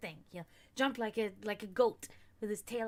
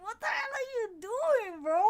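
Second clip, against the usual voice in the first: high, louder mock outrage; hammed-up incredulous telling-off; exasperated scolding; 13 semitones higher, 6 dB louder than usual. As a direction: scold the cat in squeaky disbelief. Acted scolding of cat, retold.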